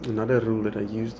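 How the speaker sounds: ordinary talk, nothing marked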